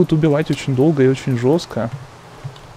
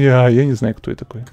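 ordinary talk, nothing marked

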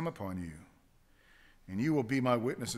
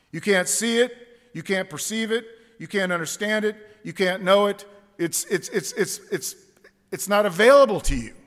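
forceful preaching; 6 semitones higher, 10 dB louder than usual. Real, not acted. Preacher building to a climax.

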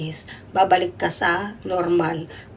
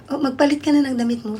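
put-on higher, lightly sing-song nanny voice to the boy; telling child to change; 7 semitones higher, 3 dB louder than usual. Nanny telling the boy to change, light and higher. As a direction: coax the kid into changing clothes.

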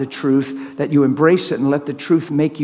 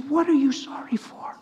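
soft, higher-pitched puzzled question; perplexed; 8 semitones higher, 8 dB quieter than usual. Retold or imitated. Preacher retelling himself asking his wife, softly perplexed. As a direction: ask it gently, bewildered. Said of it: "I was perplexed".

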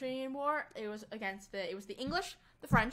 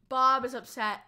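normal talking voice

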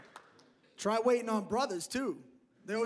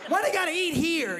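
loud, higher-pitched complaint; annoyed outburst; 6 semitones higher, 6 dB louder than usual. Retold or imitated. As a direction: gripe loudly about customers showing up. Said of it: "I used to get mad".

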